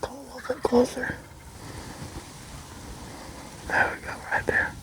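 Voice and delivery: hushed whisper, barely voiced. Real, not acted. Hunter coaxing a turkey closer in a hushed whisper. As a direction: breathe the words so the bird won't hear.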